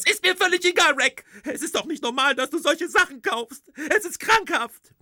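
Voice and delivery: very high, loud indignant wife; wife confronting Rick about the skeleton, very high and loud; outraged wife. Acted. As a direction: demand an answer at full pitch. Wife's shrill outrage.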